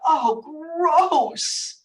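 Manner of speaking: high, drawn-out disgust; disgusted exclamation. Retold or imitated. Dog owner finding a rawhide in her bed, disgusted exclamation. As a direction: exclaim it aloud in revulsion. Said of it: "I remember saying aloud".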